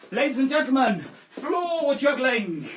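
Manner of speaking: loud, high, slow circus announcer; showman announcer. Acted. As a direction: sell the trick big to the crowd. Grand showman trick announcement.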